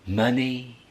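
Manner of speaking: usual speaking voice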